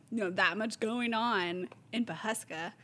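ordinary talk

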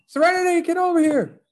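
very high, sing-out parental call. Retold or imitated. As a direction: imitate a dad calling his daughter over. Father calling his daughter over.

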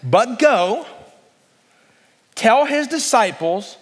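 slow, lifted reading of the angel's words; slow scripture reading. Shown as a demonstration. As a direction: read it slowly and let it build. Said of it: "listen to the angel's next words".

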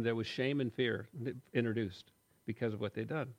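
ordinary talk, nothing marked

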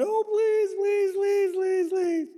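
high whiny pleading, hammed up; mock groveling beggar. Preacher mimics a sinner begging God, high whiny pleading. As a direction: beg like a groveling sinner on his knees.

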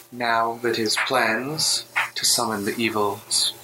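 a hint of an eerie voice in the mind; echoing female voice. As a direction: speak like a distant voice echoing in the head. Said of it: "female voice kind of echo in your mind".

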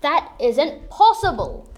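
loud and slow, refusing to believe; disbelieving shout. Acted. Interrogator disbelieves story.

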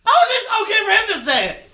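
loud, high-pitched protest; indignant outburst. Real, not acted. Friend protesting Spencer gets away with it, indignant outburst. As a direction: yell it in outraged disbelief.

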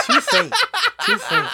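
high-pitched teasing repeat; high mock voice. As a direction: squeak it out in a high voice. Mocking the faint accents just named.

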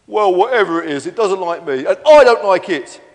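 a loud, mildly petulant dinosaur; lightly voiced Stefan, sulky. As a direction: grumble loudly, punching the end.

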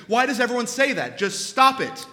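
loud, high, rapid comic rant; exasperated outburst. Acted. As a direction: lose patience and yell it. Counselor exasperated with confused client.

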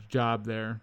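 normal talking voice

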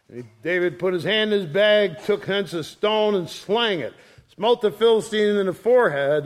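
scripture reading. Acted. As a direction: read it out like a preacher.

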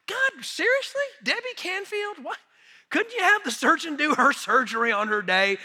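high-pitched, exasperated questioning; retold mild anger. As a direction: protest to God in mock disbelief. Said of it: "I was a little mad at God".

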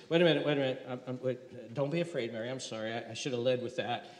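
composed, reassuring. Acted. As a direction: angel calming a frightened Mary, soft and low. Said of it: "he kind of gets his composure".

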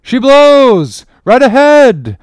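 high, full-force drawn-out lookout's shout; bellowed whale sighting, each cry stretched; masthead cry. A narrator's reading. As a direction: call it out long and loud to the whole ship. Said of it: "was now the masthead cry".

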